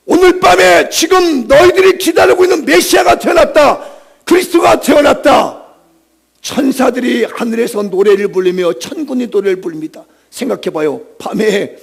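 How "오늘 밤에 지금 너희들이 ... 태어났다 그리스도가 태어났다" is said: high, loud heralding cry; angelic proclamation. Acted. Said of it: "그러면서 외치는 거죠".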